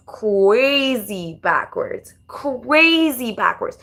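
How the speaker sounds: "crazy" stretched very long; slow, exaggerated "crazy", then "backwards"; emphatic drawn-out word. Real, not acted.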